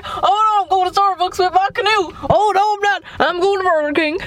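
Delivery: high-pitched mock dismay, laughing at him; hammed-up comic running commentary; imitating passer-by. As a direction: put on a high, silly voice. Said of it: "He's like".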